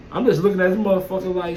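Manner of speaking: ordinary talk, nothing marked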